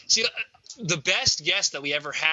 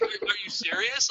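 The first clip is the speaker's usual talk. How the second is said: incredulous; 14 semitones higher than usual. Pretend. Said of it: "it was just like".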